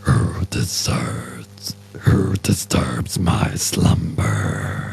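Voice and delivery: slow, breathy, menacing giant; rumbling giant voice. Retold or imitated. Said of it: "If I can do the impression right".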